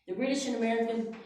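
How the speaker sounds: normal talking voice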